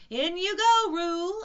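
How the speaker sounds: high, very loud command. Acted. Rabbit sending Roo into the pocket, loud call. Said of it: "Rabbit said in a loud voice".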